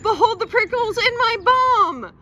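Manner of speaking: high, loud yell; shouted to Mom. Acted. Child yelling to mom about prickles, loud panicked yell. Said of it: "I shouted".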